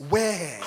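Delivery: laughing, "where" with a puffed wh; the proper school "where" with breath on wh; school pronunciation. Shown as a demonstration. Recalls the elocution teacher's demand, laughing. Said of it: "but now it has to be".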